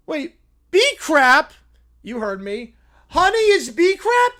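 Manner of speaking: high, loud disbelief; little bear stunned by the news; shocked little bear. Acted. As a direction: squeal it in horror.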